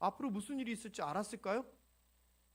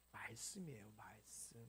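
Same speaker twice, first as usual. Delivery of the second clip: hushed whispered emphasis; preacher whispers the key word to the congregation; 13 semitones lower, 16 dB quieter than usual. Real, not acted.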